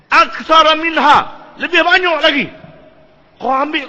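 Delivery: preacher marvelling at food multiplying, high and loud; amazed emphasis. Real, not acted. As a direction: lift the voice in wonder at the miracle.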